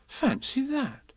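slow, swooping astonishment; drawn-out motherly wonder; mock-surprised Kanga. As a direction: marvel with feigned innocence.

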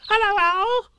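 very high, loud squeak; squeaky Roo. A narrator's reading. Little Roo greeting Pooh, high excited squeak.

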